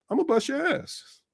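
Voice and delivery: high, soft, slow threat; mock threat. Retelling a threat to a classmate, mock menacing. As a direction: draw out a playful schoolyard warning. Retold schoolyard threat, softer and drawn out.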